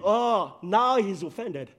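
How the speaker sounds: ordinary talk, nothing marked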